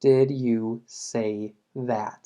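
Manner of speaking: very slow, falling on did; very slow classroom model, falling on did; slow teaching read. Shown as a demonstration. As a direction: draw the model out word by word. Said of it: "en este ejemplo".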